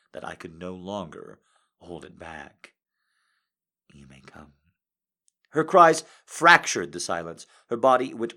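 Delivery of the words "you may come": soft command. Acted. Commanding lover granting release.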